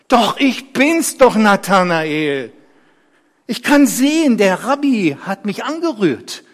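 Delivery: high, loud, insistent; aufgeregt beteuernd. Acted. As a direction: insist joyfully to the doubting neighbour. Geheilter beteuert, er kann sehen. (aufgeregt beteuert)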